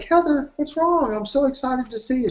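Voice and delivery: high, bright-eyed mock cheer; overexcited greeting. Greeting a scowling Heather on set, in high excited cheer. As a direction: gush at her like nothing's wrong.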